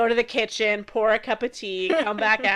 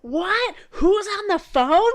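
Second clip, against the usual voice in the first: high, slow shouted question over imagined party noise; mock stressed yell; 10 semitones higher, 5 dB louder, slower than usual. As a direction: imitate her yelling over a party. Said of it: "yelling, like, really stressed out".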